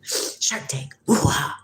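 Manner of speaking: a breathy whispered chant of "Shark Tank"; playful whisper. Pretend. Teasing the viewer Shark Tank in a playful whisper. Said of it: "Makes me wanna say".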